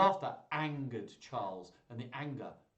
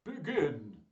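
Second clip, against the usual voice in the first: loud ducal command; 2 semitones higher, 5 dB louder than usual. A narrator's reading. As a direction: order it like a ruler opening combat. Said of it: "Duke Frederick commanded".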